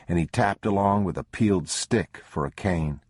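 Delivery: normal talking voice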